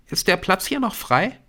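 high-pitched schoolgirl, rising question; affected girl's voice. A narrator's reading. Brynn asks Nick for the free seat, high and affected. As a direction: ask in a high, put-on girl's voice.

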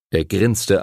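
plain reading voice

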